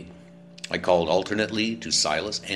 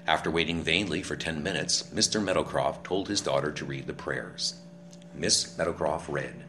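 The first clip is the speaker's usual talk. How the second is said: ordinary talk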